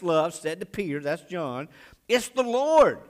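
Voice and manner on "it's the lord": high, loud cry of recognition; excited exclamation. Acted. John telling Peter who is on shore, excited exclamation. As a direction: burst out with the news, pitched way up.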